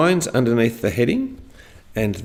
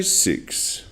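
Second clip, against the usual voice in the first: "Six" dropped low, toneless; low intonation; 3 semitones lower, 4 dB quieter than usual. Shown as a demonstration. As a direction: let "Six" fall away low and soft. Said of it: "look down at the ground and say".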